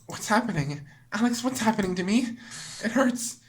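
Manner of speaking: lightly voiced pained girl, rising panic; a hint of a wounded Monika pleading; hurt Monika. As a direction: play her confused and in pain. Reading Monika's lines in voice.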